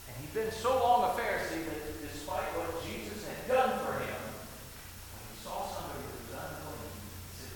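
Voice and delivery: ordinary talk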